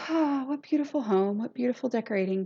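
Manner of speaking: a light put-on gushing voice, breathy and airy; mild mock swoon over a pretty home; imitating Carrie Ann. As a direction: gush like an admiring fan, lightly. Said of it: "I'm gonna do a Carrie Ann accent".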